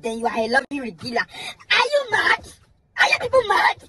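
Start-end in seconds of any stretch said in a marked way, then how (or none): 1.2-3.8 high, slow, disbelieving are you mad; incredulous outrage. Real, not acted. Ranting vlogger asks viewers in high outraged disbelief.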